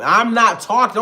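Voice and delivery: loud, high acted retort; snappy interruption. Girlfriend cutting off partner's guess, loud and snappy. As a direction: jump in hard over them.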